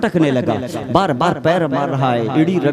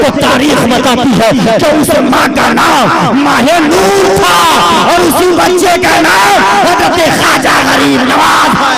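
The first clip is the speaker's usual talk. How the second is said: high, loud impassioned sermon climax; fiery preaching; 9 semitones higher, 8 dB louder than usual. Acted. Preacher revealing the story's hero to the crowd, shouted. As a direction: thunder the revelation to the hall.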